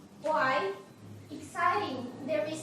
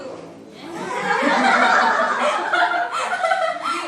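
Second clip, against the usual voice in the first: long, high peal of laughter; loud burst of laughter; 13 semitones higher, 13 dB louder than usual. Real, not acted. Teacher puts a student on the spot, loud laughing.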